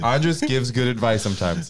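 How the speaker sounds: slow, grand takeaway line; mock announcement. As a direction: announce it like a solemn motto. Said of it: "that's the big takeaway".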